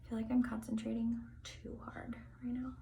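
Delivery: soft unvoiced whisper to self. Real, not acted. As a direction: whisper it as a private aside. Aside while braiding on camera.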